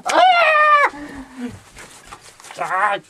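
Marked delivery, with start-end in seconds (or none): 0.1-0.9 piercing high scream. Acted. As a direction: scream as if attacked. Screams in fight.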